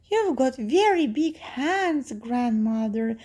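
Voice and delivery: put-on high-pitched wondering little girl. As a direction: marvel in a high child's voice.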